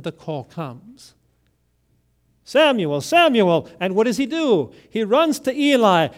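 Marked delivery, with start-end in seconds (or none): 2.4-3.7 calling out. Acted. God calling boy in night.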